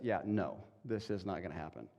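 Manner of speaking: a quiet, settled refusal; God saying no. Retold or imitated. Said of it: "God looks at this and says".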